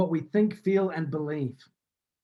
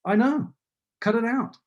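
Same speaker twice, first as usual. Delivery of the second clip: higher-pitched retort of a fed-up mum; mock maternal exasperation, pitched up; exasperated mother; 5 semitones higher than usual. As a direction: imitate your mother telling you off. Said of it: "And she'd say".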